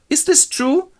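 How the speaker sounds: high, loud demand; hammed-up shocked interrogation; questioning father. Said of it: "questioned by her father".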